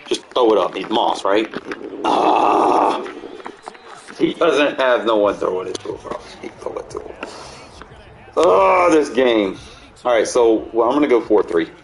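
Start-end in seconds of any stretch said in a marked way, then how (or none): 2.0-3.0 loud, voiceless exasperated "ah"; harsh airy groan; frustrated cry. Real, not acted. Play fails in the game. 8.4-9.6 high, loud "oh" falling away; loud wail sinking into annoyance; exasperated wail. Real, not acted. Frustrated with the game.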